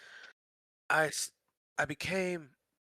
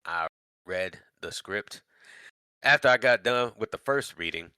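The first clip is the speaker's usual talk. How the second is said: normal talking voice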